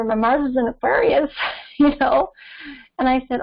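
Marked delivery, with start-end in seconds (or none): none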